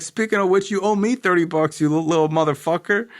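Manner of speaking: high, teasing put-on voice; lightly voiced cheeky friend; imitating Zach. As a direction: needle him like a cocky friend. Zach teasing about debt.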